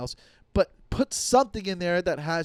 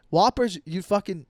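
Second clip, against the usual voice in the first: ordinary talk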